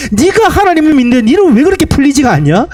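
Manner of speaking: high-pitched, taunting reproach; acted reviling. Friends reproaching a suffering believer like Job, acted taunting. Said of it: "나를 욕하기 시작합니다".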